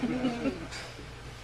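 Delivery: soft laugh. Real, not acted. Laughing at own joke.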